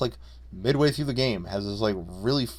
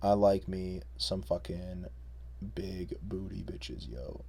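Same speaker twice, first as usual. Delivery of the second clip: deep bro voice; 3 semitones lower, 9 dB quieter, slower than usual. Pretend. Low mock bro voice.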